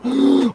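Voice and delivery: excited gasp. Acted. Excited about painting.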